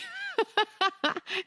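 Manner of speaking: quick high laugh breaking out. Real, not acted. Laughing at comparing herself to hundreds of runners. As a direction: let a bright laugh burst.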